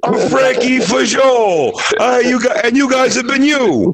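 very high, drawn-out stage farewell; hammed-up end-of-set shout-out; comic sign-off. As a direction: belt out the name as a hack comedian. Mock comic signing off.

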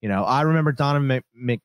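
ordinary talk, nothing marked

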